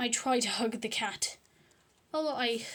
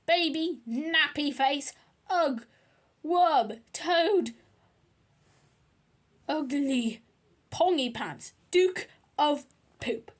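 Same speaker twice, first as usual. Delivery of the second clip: loud, higher volley of insults; Henry shouting names at his brother; 4 semitones higher, 7 dB louder, slower than usual. Acted. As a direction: fire off the names like taunts. Henry hurling insults at Peter.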